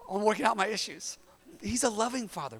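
ordinary talk

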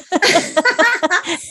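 high-pitched burst of laughter; amused, high-pitched laughter. Real, not acted. Laughing at the absurdity.

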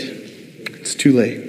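slow, voiceless "too late"; whispered warning. Shown as a demonstration. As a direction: breathe "It's too late" like a grim warning.

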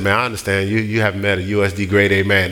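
low, unruffled de-escalation; composed, matter-of-fact firmness; calm reassurance. Retold or imitated. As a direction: talk a threatening man down, low and even. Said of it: "I said it exactly like that".

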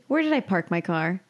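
usual speaking voice

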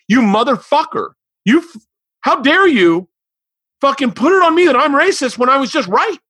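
high, loud furious tirade; shouting, indignant, way up high; furious rant. Retold or imitated. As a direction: blow up at him in outrage. Retold furious outburst at man.

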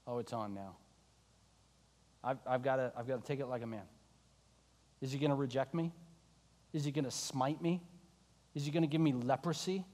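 Peter whispering his dread low and soft; fearful hush. Acted. Peter dreading meeting Jesus, hushed fear. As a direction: hold the fear in, barely voiced.

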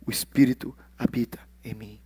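soft unvoiced confession of faith; hushed whisper; whispered conviction. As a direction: breathe it out like a secret.

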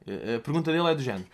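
ordinary talk

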